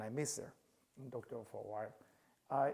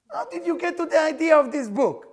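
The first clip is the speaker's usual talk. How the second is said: higher, louder mimicking his mother's question; imitating mom; 11 semitones higher, 12 dB louder than usual. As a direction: play his mom, pitched up and loud.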